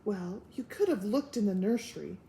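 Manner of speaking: ordinary delivery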